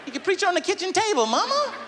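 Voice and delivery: put-on high, sassy kid voice; cheeky kid. Child telling mama to preach at home, high and cheeky. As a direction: sass her up high.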